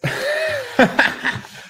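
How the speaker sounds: very high giggle; high squeal of laughter. Real, not acted. Laughs at first kiss question.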